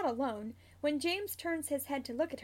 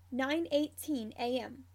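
ordinary delivery, nothing marked

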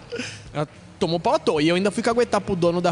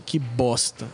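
mock sneer; 8 semitones lower, much slower than usual. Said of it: "Desse jeito".

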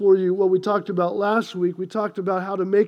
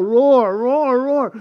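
put-on loud childish roars fading off; playful loud roaring; kid roaring; 4 semitones higher, 5 dB louder, much slower than usual. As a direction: roar again and again like a little bear. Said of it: "he would go".

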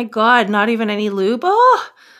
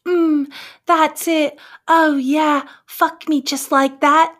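breathy moaning; 7 semitones higher, slower than usual. Acted. Emma moaning.

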